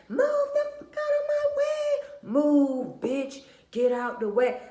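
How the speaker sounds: loud, high-pitched acted road-rage yell. Irritated driver yelling at traffic after meeting a witch, acted road rage. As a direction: scream at the car in front, hammed-up. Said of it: "You got road rage like a mug".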